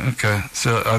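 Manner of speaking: ordinary delivery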